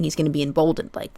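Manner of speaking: usual speaking voice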